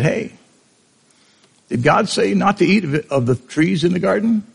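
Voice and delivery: lightly voiced snake luring her in; sly serpent.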